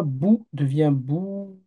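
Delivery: "bou" short first, then the second "bou" held long, slow; slow contrast of short and long "bou"; short then long vowel. Shown as a demonstration. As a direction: stretch the second "bou" to two beats. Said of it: "avec cette voyelle ici".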